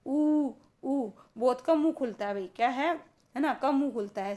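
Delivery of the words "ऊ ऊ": ऊ, ऊ said with rounded, nearly closed mouth; vowel demo. Shown as a demonstration. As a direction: round lips and hold ऊ. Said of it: "जैसे आप बोल के देखो".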